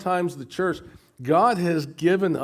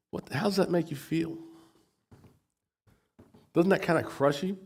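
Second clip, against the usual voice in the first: normal talking voice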